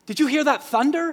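high, loud startled question; astonished onlooker. Acted. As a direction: play a bystander amazed at a sudden noise. Startled crowd voiced in Gospel story.

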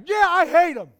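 loud, high, faking conviction; forced agreement. Retold or imitated. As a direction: shout a hate you don't feel. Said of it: "yeah, you're just like".

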